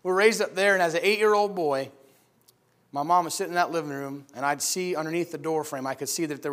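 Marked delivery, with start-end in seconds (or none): none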